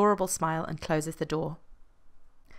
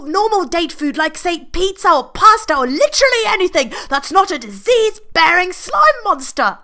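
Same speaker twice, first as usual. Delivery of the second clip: high, loud disgusted tirade; a sick man yelling at his partner; furious rant; 13 semitones higher, 13 dB louder than usual. Acted. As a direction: rant at the oysters with full disgust. Roger ranting about the oysters.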